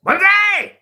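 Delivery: very high, loud Japanese battle cry; mock Japanese accent. Ending the wise man bit, shouted Japanese accent. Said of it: "Can you do a Japanese accent?".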